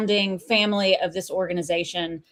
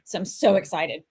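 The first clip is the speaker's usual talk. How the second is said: normal talking voice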